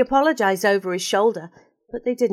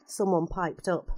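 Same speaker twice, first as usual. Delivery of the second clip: ordinary delivery, nothing marked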